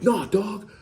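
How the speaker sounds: high-pitched, indignant imitation; imitated high-voiced rant; mock going off. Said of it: "he's just going off like".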